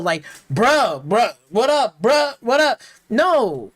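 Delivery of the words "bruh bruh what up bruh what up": mock "bruh" greetings, punched out; mocking imitation. Reviewer parodying how the game's racers talk, mocking. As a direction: parody stilted slang dialogue. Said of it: "They don't talk like that at all".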